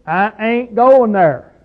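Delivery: slow hillbilly accent; hillbilly twang. Shown as a demonstration. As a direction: drawl it like a hillbilly refusing temptation. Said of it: "Doesn't make any difference how you say it".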